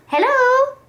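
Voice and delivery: high, loud sugary hello; sweet calling hello. A narrator's reading. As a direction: sing out a bright greeting. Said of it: "called the lazy bird".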